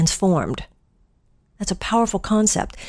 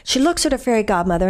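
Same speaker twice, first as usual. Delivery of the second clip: usual speaking voice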